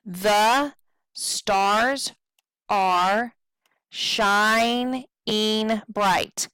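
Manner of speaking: slow, broken into separate beats; syllable-by-syllable. Shown as a demonstration. Teacher counting out a line of verse, syllable-by-syllable. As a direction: sound out the line syllable by syllable with pauses. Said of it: "We have to determine stressed and unstressed".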